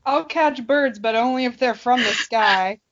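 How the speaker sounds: usual speaking voice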